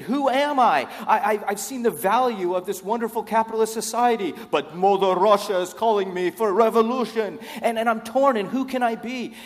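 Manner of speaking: high, loud, hammed-up inner turmoil; conflicted sleeper agent. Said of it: "And then they're conflicted".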